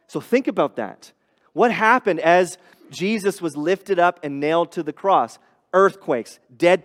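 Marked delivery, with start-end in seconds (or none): none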